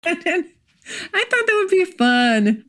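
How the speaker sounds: high-pitched, drawn-out laughing delivery. Real, not acted. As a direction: say it through a real laugh. Laughs while joking about ideas.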